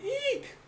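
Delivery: very high squeaky eek sound; high squeal. Acted. Narrator voicing the panel's squeal, very high. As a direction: squeak it like a tiny shriek.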